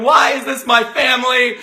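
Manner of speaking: high, loud mock wail; hammed-up tearful complaint; imitated crying sister. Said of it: "your sister is crying".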